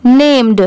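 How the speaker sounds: "नेम्ड" with a clear d after the m; final d sounded. Shown as a demonstration. Teacher showing students the d at the end. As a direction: close "नेम्ड" on a crisp d. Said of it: "यहाँ पर डी लगने की वजह से इसको हम लोग नेम्ड पढ़ेंगे".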